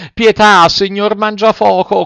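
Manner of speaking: very high puppet begging; high, imploring little voice; pleading. A narrator's reading. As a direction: beg for mercy, loud on the first word. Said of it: "con voce supplichevole".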